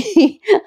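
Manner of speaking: a sudden high amused laugh; high-pitched laugh. Real, not acted. Vlogger cracking up over the serial scene, laughing high. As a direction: burst out laughing at the drama.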